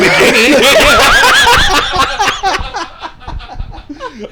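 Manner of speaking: loud high laughing burst; big laugh. Real, not acted. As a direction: break into open laughter.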